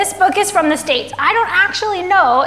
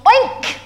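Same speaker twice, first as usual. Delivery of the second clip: short, high imitated oink; piggy oink; 8 semitones higher, 4 dB louder than usual. A narrator's reading. Piggy in a children's story, high oink. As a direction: squeal out a quick oink. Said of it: "said the piggy".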